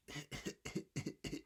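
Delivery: a tiny breathy little cough. Shown as a demonstration. Teacher showing the class the flea's tiny cough. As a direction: cough like a flea would. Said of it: "It sounded like this".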